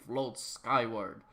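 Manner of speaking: ordinary delivery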